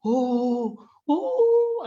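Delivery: high drawn-out fake wail; hammed-up "oh-oh" boo-hoo; mock crying. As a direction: imitate a man sobbing. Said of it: "one man started crying".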